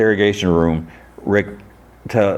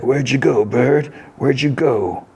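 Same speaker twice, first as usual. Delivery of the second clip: higher-pitched call to a hidden bird; searching call; 7 semitones higher, slower than usual. Acted. Saint Wilde hunting the bird in the trees, calling.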